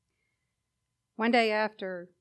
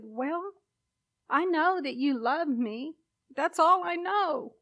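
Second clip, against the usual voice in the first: put-on brokenhearted child; 7 semitones higher, 5 dB louder than usual. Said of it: "like a little child, brokenhearted".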